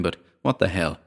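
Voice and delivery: usual speaking voice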